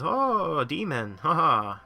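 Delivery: high, loud hammed-up gasp-and-laugh reaction; mock amazement. Player mimicking reaction to a pricing joke, mock amazement. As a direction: swoop up high in fake awe, then a token laugh.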